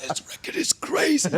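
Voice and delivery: high, laughing excited voice; imitating the label boss. Label boss calling about the record, high excited imitation. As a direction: gush over the record. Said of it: "I like to imitate people".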